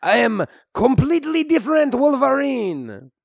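put-on comic French-Canadian accent; hammy Québécois hero voice. French-Canadian Wolverine character.

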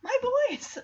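high-pitched cry of recognition; Sassy. Acted. Cat hearing her boys barking, playfully thrilled. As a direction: call out high, mock delighted. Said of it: "then she's like".

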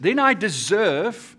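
"deserve" drawn out slowly and loud; emphatic. Acted. Preacher voices people claiming they earned it, emphatic. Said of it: "we put the emphasis on it".

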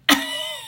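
a sudden squeal of a giggle; high squeaky laugh. Real, not acted. Remembering the hummingbird at her window, a high giggle.

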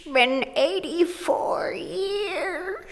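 put-on high, very slow, trembling old-woman voice; crackly elderly wail, drawn way out; old lady quote. Host opening her podcast back after a long gap, in a quavering old-lady voice.

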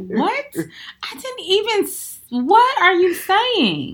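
high, slow baffled voice, dragging the words out; confused disbelief. Retold or imitated. To a boyfriend rambling on, high drawn-out confusion. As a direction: act utterly lost mid-argument, stretching every word. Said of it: "Be like".

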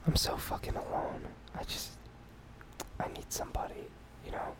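very soft, whispered fake heartbreak; mock despair. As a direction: whisper a put-on lonely confession, haltingly. Mock lonely breakdown as a bit.

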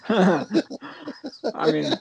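laughing. Real, not acted. Laughing at the Doolittle question.

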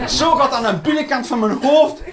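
ordinary talk, nothing marked

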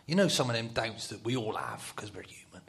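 usual speaking voice

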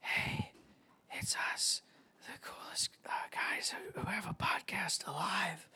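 breathy sneaky whisper. Acted. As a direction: hush it like telling a secret. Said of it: "a nice little sneaky, uh, psst".

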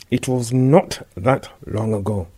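slow words through clenched teeth. Acted. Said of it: "came through clenched teeth".

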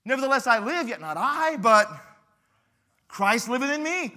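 loud, slow preaching of the verse; fiery sermon quote; preaching scripture. Acted. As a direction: proclaim it with full force. Preacher quotes Paul fervently.

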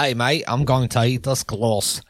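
copying a synthetic Australian voice; broad put-on Aussie vowels; attempted Australian accent. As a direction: imitate an Australian accent, lightly hammed up. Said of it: "in Australian".

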